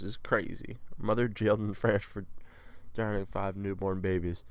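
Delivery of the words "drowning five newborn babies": ordinary delivery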